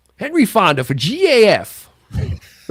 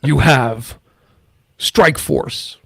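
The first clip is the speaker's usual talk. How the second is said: movie trailer voice; 5 semitones lower than usual. Shown as a demonstration. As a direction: boom it like a trailer. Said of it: "in a world".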